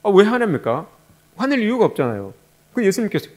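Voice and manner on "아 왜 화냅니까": ordinary talk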